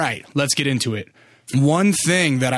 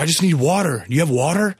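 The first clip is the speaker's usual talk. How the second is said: slightly higher, imitated pained plea; grunting and groaning; 3 semitones higher than usual. Homeless man asking for water, imitated pained groaning. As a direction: groan the request as if hurting. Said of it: "almost grunting and groaning, like, his words".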